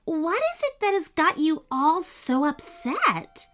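put-on high, gentle, polite little girl. A narrator's reading. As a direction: ask ever so politely. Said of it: "said Ananya politely".

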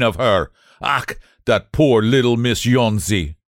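put-on loud, gruff old German painter scoffing; thick German accent, full of contempt; scornful German accent. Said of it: "shouted his contempt and derision".

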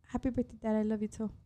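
ordinary delivery

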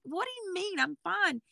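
high, soft protest; retold squeaky-high insistence of being okay; baffled denial.

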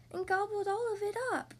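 high little bear-cub voice; small, high, childish cub; Baby Bear squeak. A narrator's reading. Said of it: "squeaked, squeaked Baby Bear".

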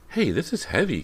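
put-on girl handed a heavy carton; surprised cry. A narrator's reading. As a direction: caught off guard by the weight. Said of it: "Callie cried".

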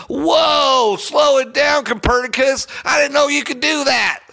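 high, mocking fake amazement; teasing a pundit for an obvious prediction, sarcastic; sarcastic mock awe. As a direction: gush like he's a genius, dripping sarcasm. Sarcastic mock praise of Tebow.

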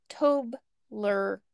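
Tobler broken into "TOHB... ler"; sounding it out. Shown as a demonstration. Teaching co-host the candy's name, Tobler sounded out syllable by syllable. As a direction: say Tobler in two separate beats, rhyming the start with table. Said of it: "So it's like table".

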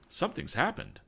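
yell. A narrator's reading. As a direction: call out urgent news. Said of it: "yelled Tom".

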